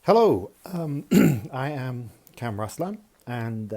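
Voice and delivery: usual speaking voice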